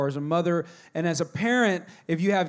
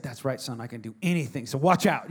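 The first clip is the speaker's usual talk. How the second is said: much lower, softer mock-boastful dad; proud dad; 7 semitones lower, 4 dB quieter than usual. Dad answering his son, lower mock-boastful voice. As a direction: play the all-powerful dad. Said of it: "And I said".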